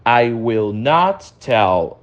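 "I will not tell" drawn out very slowly; slow teacher demo. Shown as a demonstration. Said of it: "eu não vou contar".

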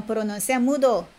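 usual speaking voice

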